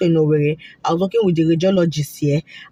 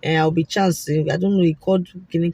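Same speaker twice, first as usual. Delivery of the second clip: ordinary talk, nothing marked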